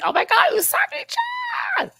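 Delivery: high, slow fangirl squeal; excited squeal. Real, not acted. Fangirling over Sailor Moon, high excited squeal. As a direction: squeal like an anime fan.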